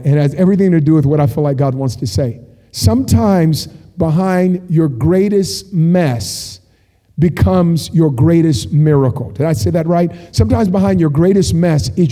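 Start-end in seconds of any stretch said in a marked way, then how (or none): 2.7-9.3 very slow, weighty phrases with pauses; slow and deliberate. Real, not acted. Preacher sharing what God wants to say, slow and deliberate. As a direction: spell out the key message phrase by phrase. Key line, then restated after checking.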